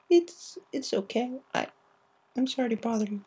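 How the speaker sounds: brave through tears; tearful. Acted. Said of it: "tears in her eyes".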